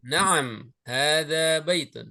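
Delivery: "Naam, hadza baitun" slow, falling at the end; statement intonation. Shown as a demonstration. Teacher models a yes answer for students, declarative "Naam, hadza baitun". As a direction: confirm "Naam, hadza baitun" unhurried, ending downward. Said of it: "Intonasi istifham, ya, dengan pernyataan itu berbeda".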